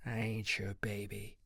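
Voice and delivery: low-volume grumbled retort; muttered. A narrator's reading. Ivy rejects Chansey's pet name, muttering. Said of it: "Ivy muttered".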